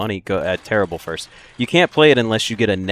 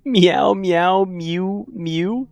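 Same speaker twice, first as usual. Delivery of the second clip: high-pitched kitty meows, the first ones loud; cat meowing; 10 semitones higher, 3 dB louder, much slower than usual. Pretend. Streamer obliges a donor's request, high cat meows. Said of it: "making me meow".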